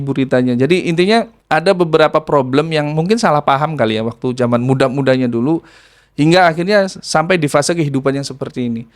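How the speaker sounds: ordinary talk, nothing marked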